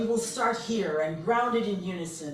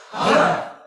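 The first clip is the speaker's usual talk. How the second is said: loud explosive huh; powerful huh; 5 semitones higher, 15 dB louder than usual. Shown as a demonstration. Leader ending the affirmation, explosive huh. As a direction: cap the chant with a burst of breath. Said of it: "our voices with a huh".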